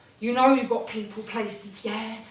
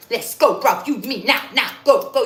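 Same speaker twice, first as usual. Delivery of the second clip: loud, pumped-up shouting; hyped shout; 11 dB louder than usual. Acted. Comic pumping himself up, shouting. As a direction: fire yourself up and yell it.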